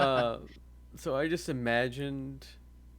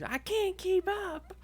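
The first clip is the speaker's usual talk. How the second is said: high whiny voice; slower than usual. Retold or imitated.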